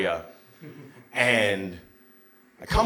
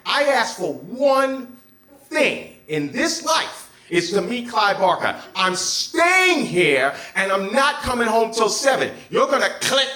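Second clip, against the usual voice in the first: high, loud protest at mom; retold teen outburst; 7 semitones higher, 5 dB louder than usual. Arguing with his mother over a chance to meet his idol, loud, high complaint. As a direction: whine it like an aggrieved teenager demanding his due.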